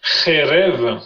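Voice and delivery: "Kherev" with a throaty kh at the start; chet sound. Shown as a demonstration. Teacher repeating the word for a student, throaty kh in "Kherev". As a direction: scrape the first sound of "Kherev". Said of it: "c'est un chet".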